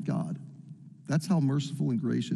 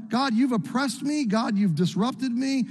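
desperate complaint; 7 semitones higher than usual. Retold or imitated. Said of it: "Think about this man now".

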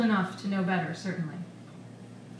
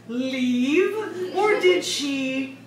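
loud, slow, higher-pitched questioning; high volume; 5 semitones higher, 7 dB louder, much slower than usual. A narrator's reading. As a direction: spell it out loudly for someone not understanding. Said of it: "spoke at a high volume".

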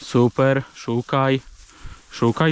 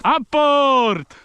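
high, carrying call to a dog; sharp high dog command; 10 semitones higher than usual. Real, not acted. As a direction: call the fetch command across the field. Calling the dog to fetch.

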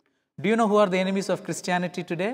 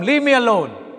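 louder, cutting dismissal; angry brush-off; 5 semitones higher, 6 dB louder than usual. Acted. Upset Mohan snapping at his wife after coming home early, angry. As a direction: shut your wife down. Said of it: "in an angry upset".

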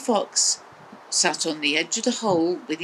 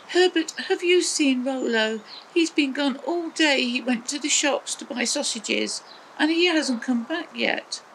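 Maggie asking; 7 semitones higher than usual. A narrator's reading. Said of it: "And Maggie said".

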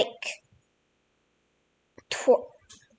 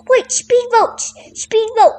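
excited; 6 semitones higher than usual. Real, not acted. Spots speedboats in game.

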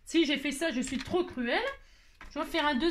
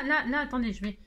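ordinary talk, nothing marked

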